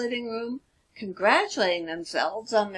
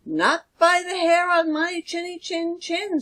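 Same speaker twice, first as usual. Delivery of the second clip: higher, chirpy pig voice; defiant little pig; 8 semitones higher than usual. Acted. Stella refuses the wolf in a higher piggy voice. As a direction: stand firm, cheeky and bright. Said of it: "said Stella".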